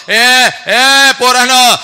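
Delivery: put-on drawn-out loud call to the kids. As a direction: festive father hollering at the children. Father calling children at feast.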